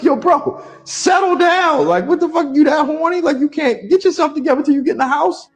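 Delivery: disbelieving outburst. Retold or imitated. Man retelling telling friend to settle down, high and incredulous. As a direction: squeak it out in disbelief. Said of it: "I'm sure I'm like".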